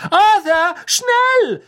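very high, loud shout; Misses Weasley calling urgently. A narrator's reading. Misses Weasley hurries her husband as train departs, high shriek. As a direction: yell to hurry your husband. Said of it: "rief Misses Weasley".

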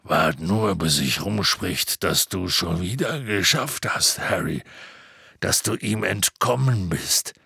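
tiefe, stolze Riesenstimme; Hagrids Stimme. A narrator's reading. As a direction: prahl tief und polternd für Harry. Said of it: "sagte Hagrid". (tiefe Halbriesenstimme)

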